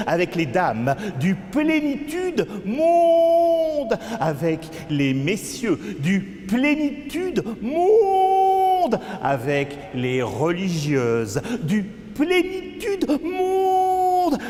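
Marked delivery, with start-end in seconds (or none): 1.2-4.0 high, slow drawn-out cry; theatrical wailing chant, very high; wailed refrain. Acted. Refrain cried out in poem. 6.0-9.0 high, slow drawn-out cry; theatrical wailing chant, very high; wailed refrain. Acted. Refrain cried out in poem. 11.6-14.4 high, slow drawn-out cry; theatrical wailing chant, very high; wailed refrain. Acted. Refrain cried out in poem.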